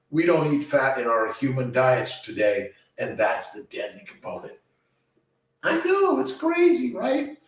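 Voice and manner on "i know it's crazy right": much higher, excited and amused; excited squeal. Real, not acted. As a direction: squeal it out in disbelief. Reacts to a viewer's comment.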